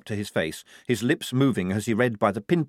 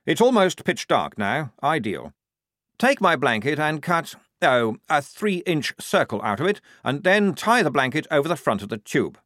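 higher, eager officer voice giving orders; a keen lieutenant pleased with his plan; fussy young officer; 6 semitones higher, 4 dB louder than usual. Acted. Lieutenant ordering the assistant, higher and eager.

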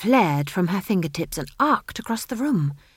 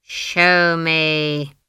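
loud, drawn-out, menacing order; icy command; 6 dB louder than usual. A narrator's reading. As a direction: command it with ice in the voice. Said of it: "her voice had ice in it".

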